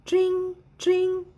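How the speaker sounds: imitated phone ring tone; phone ring imitation. A narrator's reading. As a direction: mimic a phone ringing down the line. Phone ringing in the story.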